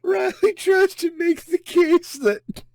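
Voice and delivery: high, slow laugh-strained voice. Real, not acted. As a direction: fight back the laughter. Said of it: "I can't stop laughing".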